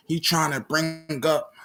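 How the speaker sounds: usual speaking voice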